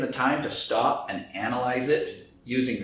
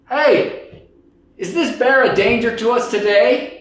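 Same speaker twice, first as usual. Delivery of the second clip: raised voice calling out; louder call; 9 semitones higher, 7 dB louder than usual. Acted. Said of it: "I'll speak up".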